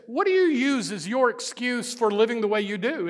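ordinary delivery

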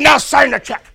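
loud, high-pitched demand; acted strong-arm threat, shouted; forceful order. Teller roughs up farmer in story.

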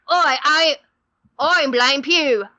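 playful Cockney buccaneer put-on; Cockney pirate. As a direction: growl out a mock Cockney pirate intro with arg. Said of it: "it was like a Cockney thing".

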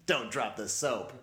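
ordinary talk

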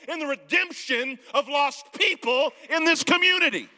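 loud, high, slow sermon build; preaching at full force. Real, not acted. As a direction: build to a rousing shout.

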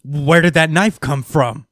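mock editor demanding where the knife came from; mock baffled editor. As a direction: play the confused editor asking. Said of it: "is just like".

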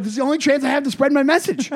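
high-pitched mock urgency; hammed-up zealous outburst; urgent zealot. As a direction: plead your cause as if time is short. Acting out a zealous conspiracy spreader.

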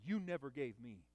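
a hint of sulking grievance; bitter son. Son's complaint repeated.

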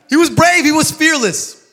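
high, loud, emphatic praise. Real, not acted. Preacher telling the church how brave Julius was, emphatic. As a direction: lift it up, proud of him.

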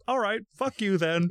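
high mock snap; mock angry retort. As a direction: imitate telling her off. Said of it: "So then I'm like".

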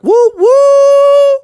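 very high, loud woo-hoo; joyful whoops. Acted. The family whooping together, loud high woo. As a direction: whoop with delight.

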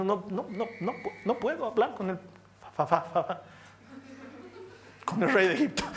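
acted stutter, stuck on the first sounds; stammering Moisés. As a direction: stammer haltingly through an excuse. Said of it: "soy tartamudo". (tartamudeo fingido, a trompicones)

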